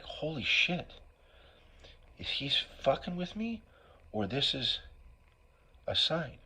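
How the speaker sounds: high shocked holy shit; stunned disbelief. Retold or imitated. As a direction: blurt it out shocked. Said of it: "I got the, like".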